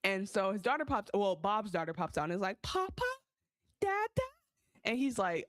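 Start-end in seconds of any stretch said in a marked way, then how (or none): 2.6-4.2 high, small voice calling papa; a little girl calling for her dad. Retold or imitated. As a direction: daughter voice, much higher. Daughter calling for her father.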